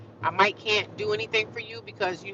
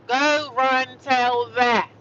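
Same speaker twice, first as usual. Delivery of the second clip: very slow, louder taunt; drawn-out taunt; 4 semitones higher, 4 dB louder, much slower than usual. Acted. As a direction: stretch out the dare.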